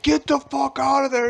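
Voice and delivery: very high, strained mock shout; retold angry yell. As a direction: imitate a pissed-off guy ordering people out. Retold pissed man ordering others out.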